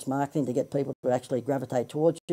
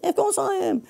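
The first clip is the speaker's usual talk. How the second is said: much higher, hammed-up stand-up lilt peaking on "course"; Seinfeld inflection again. Host repeating his quip, exaggerated Seinfeld-style lilt. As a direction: overdo the sitcom comedian's rhetorical bounce. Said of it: "I did the inflection like Seinfeld".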